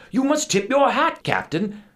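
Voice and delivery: very high, loud insistence; insistent little Dutchman. A narrator's reading. Little Dutchman to captain, high insistent plea. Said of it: "insisted the Dutchman".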